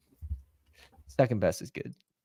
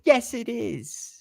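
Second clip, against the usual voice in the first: high, slow sheepish answer; meek reply; 13 semitones higher, much slower than usual. Retold or imitated. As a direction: sheepishly reassure from downstairs. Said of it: "I'm like".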